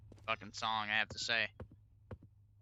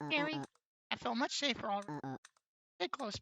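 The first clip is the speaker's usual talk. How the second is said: put-on high, small frightened child; scared kid voice; 11 semitones higher, 4 dB quieter, faster than usual. Kid asks the rug seller to walk him home, high and frightened. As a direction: play a scared little kid begging for help.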